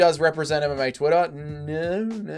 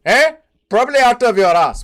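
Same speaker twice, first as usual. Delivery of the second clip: mock Indian taunt; 6 semitones higher, 7 dB louder than usual. Mock accent taunting Nacho.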